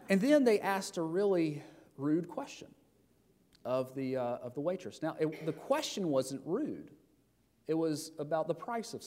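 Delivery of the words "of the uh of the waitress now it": ordinary talk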